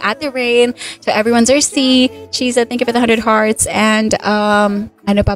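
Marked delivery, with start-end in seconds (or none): none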